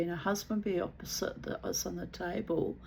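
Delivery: normal talking voice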